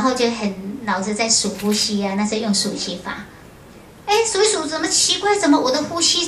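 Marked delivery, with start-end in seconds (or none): 4.1-6.3 a startled, wondering retelling; puzzled surprise. Retold or imitated. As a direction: pitch jumps up in sudden wonder. Retelling surprise during meditation.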